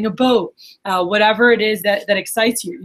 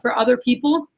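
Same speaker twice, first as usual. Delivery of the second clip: normal talking voice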